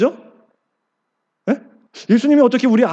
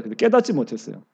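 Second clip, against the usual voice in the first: soft echo; 9 semitones lower, 6 dB quieter than usual. Shown as a demonstration.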